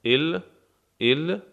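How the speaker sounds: ordinary talk, nothing marked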